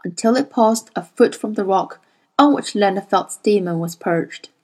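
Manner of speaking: ordinary talk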